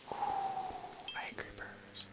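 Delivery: hushed, slow whisper. Real, not acted. Gamer hiding from a creeper, slow hushed whisper. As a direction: breathe it out very slowly.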